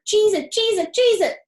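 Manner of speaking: loud, high honking warning shout; gander shouting. A narrator's reading. Gander warning the animals the people come, loud shout. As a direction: alarm the whole barnyard. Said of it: "shouted the gander".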